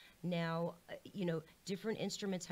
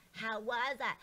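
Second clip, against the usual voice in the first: [name] voice; 3 semitones higher, slower than usual. Shown as a demonstration. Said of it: "the way that I imagine [name]".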